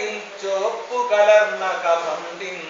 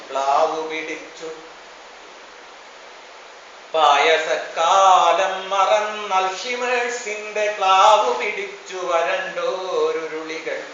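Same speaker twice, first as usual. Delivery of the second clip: ordinary talk, nothing marked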